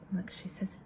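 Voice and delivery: soft secret whisper. Acted. Teacher hushing the class before the girl speaks, whispering. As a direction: lean in and whisper it. Hushed aside to children.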